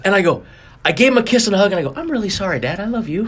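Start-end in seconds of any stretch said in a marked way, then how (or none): 2.0-3.2 softer and higher, gentle; tender apology. Retold or imitated. A grown son telling his father sorry and I love you, softly. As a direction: say it softly, like hugging your dad.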